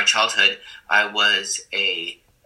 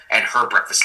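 ordinary delivery

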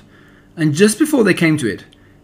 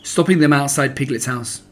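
usual speaking voice

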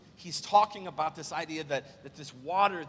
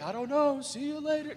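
breezy, higher-pitched mock brush-off; casual joke voice, pitched up; joking offhand reply; 8 semitones higher than usual. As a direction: toss it off as a light goodbye. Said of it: "Jesus is like".

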